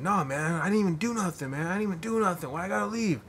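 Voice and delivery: high mock whine; imitating a whining drunk, pitched well up; whiny drunk. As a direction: protest innocence like a sulky drunk. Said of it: "And he's like".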